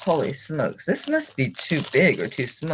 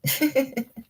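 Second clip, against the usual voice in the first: light laugh; 11 semitones higher than usual. Real, not acted. Laughs about talking to it.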